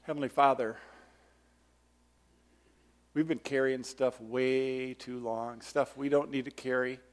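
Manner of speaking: soft, low closing prayer. Acted. Pastor leading the congregation in prayer over music. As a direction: lead the church in prayer.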